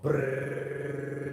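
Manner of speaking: lips buzzing like a money printer; money printer brrr. Retold or imitated. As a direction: buzz it out like a printing press.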